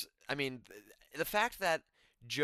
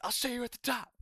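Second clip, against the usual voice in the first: high, dramatic hype voice; imitating announcer; 11 semitones higher than usual. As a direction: say it like a hype intro. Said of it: "they said".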